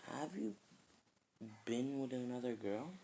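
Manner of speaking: half-whispered timid question; hesitant question. Retold or imitated. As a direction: ask it softly and tentatively. Ino hesitantly asks Naruto.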